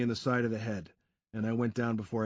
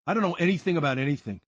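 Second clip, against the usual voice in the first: fast, louder nervous Sean; hurried denial; 5 semitones higher, 5 dB louder, faster than usual. A narrator's reading. Sean waving off the narrator, fast nervous denial. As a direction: rush to shut it down.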